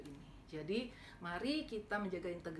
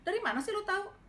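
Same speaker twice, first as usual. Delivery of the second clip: high, loud incredulous question; a retold friend asking in disbelief; surprised challenge; 12 semitones higher, 6 dB louder than usual. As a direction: demand to know how he could tell. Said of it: "Terus dia bilang".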